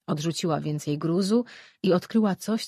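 ordinary delivery

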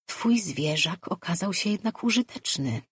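ordinary delivery

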